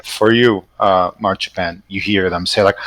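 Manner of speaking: normal talking voice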